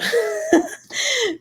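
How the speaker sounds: high-pitched laughing fit. Real, not acted. Laughing at own quote.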